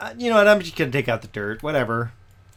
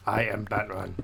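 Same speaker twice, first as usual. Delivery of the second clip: low, soft gravel-voiced Batman impression with a laugh; 7 semitones lower, 8 dB quieter than usual. Streamer showing viewers how sick voice sounded, low Batman rasp. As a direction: growl it like a bad Batman. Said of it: "I sounded like Batman".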